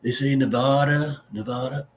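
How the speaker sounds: "Nevada" with the middle said "vah"; "Nevada" as nuh-VAH-da, the wrong way. Retold or imitated. Host mocking how outsiders say "Nevada". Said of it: "people that don't know how to say it".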